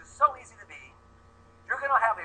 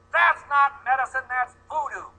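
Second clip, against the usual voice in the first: higher, louder, slow mock-dismissive scoff; scornful doctors; 5 semitones higher, 4 dB louder, slower than usual. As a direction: sneer like a pompous Western doctor. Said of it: "our doctors go".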